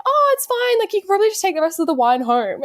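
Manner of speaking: high, sugary fake politeness; fake polite. Telling the date to take the wine home, high and fake-sweet. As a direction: brightly pretend it's fine.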